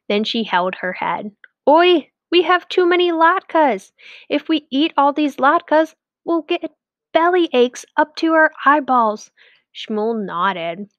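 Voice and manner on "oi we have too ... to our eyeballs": high, worried wife's voice. A narrator's reading. Wife worrying about too many latkes, high. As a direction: worry aloud to him.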